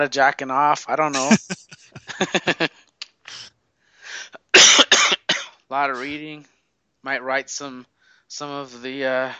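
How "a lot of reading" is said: loud slow emphasis. Acted. Exaggerated correction.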